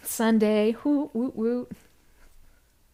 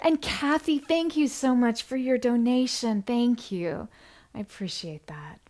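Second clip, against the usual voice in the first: usual speaking voice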